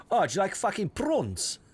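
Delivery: mock South African accent, high and slow; District Nine accent. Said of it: "they do, um, District Nine".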